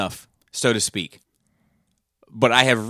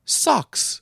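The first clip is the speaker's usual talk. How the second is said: put-on high, sour old lady sneering one word; mock old lady; 14 semitones higher than usual. Retelling The Ref, mimicking the rich old grandmother unimpressed by her gift, high mock sneer. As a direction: sniff it out like a disappointed rich grandma.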